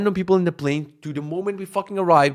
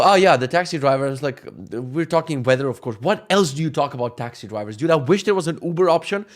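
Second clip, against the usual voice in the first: usual speaking voice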